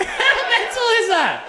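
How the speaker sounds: silent high wheeze of laughter; high wheezing laugh. Real, not acted. Big laugh.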